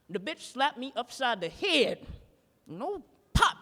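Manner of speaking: normal talking voice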